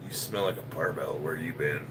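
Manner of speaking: a lightly voiced nagging wife; quoting wife. His wife asking where he has been, low soft mock voice. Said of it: "My wife said".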